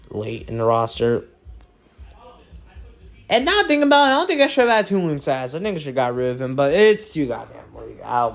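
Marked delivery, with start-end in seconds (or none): 3.3-5.1 sudden realization. Real, not acted.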